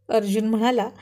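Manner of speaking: ordinary delivery, nothing marked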